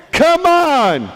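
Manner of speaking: high, drawn-out exasperated cry; exasperated outburst. Acted. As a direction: erupt in loud exasperation. Joseph's exasperation erupts.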